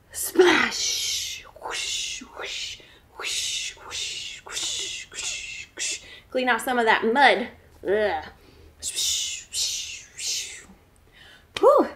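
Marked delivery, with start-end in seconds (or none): 0.1-6.0 breathy whoosh noises; whispered swimming sounds. Acted. 8.8-10.7 whispered swish. Acted. Cleaning off mud.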